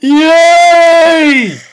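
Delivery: loud, high victory cry; real triumphant yay; cheer. Real, not acted. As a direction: shout with relief. Finally succeeds.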